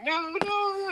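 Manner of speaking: very high, frightened pleading; imitating the cowering artist, high frightened no; mock terrified. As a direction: squeal the nos like a cornered man. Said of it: "he's, like, shaking".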